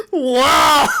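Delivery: loud laughing outburst. Real, not acted. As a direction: crack up loudly. Bursts out laughing.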